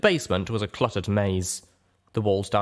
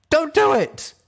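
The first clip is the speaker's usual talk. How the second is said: high, loud pleading shout; desperate shout; 6 dB louder, slower than usual. Acted. Little Pip begging Steelhooves not to open the door, high loud shout. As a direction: stop him before he opens it. Stopping friend opening door.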